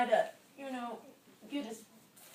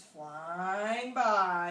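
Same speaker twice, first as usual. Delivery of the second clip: slow, loud, swooping narration; drawn-out storytelling; 2 semitones lower, 9 dB louder than usual. A narrator's reading. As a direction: stretch the words like a long glide. Storyteller stretches the bird's flight.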